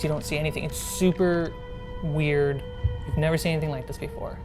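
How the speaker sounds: usual speaking voice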